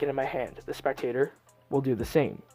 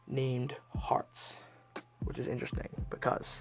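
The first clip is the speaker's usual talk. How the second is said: hushed whisper for the big reveal; theatrical magician; 9 dB quieter than usual. Acted. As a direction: whisper it like a secret. Magician's dramatic card reveal.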